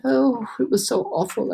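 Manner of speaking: ordinary delivery, nothing marked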